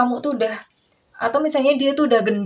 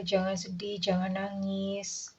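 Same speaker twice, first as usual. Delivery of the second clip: much softer, slow soothing words; soft comforting; 10 dB quieter, slower than usual. Retold or imitated. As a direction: hush them gently not to cry. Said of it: "gitu ya". (menghibur dengan sangat lembut)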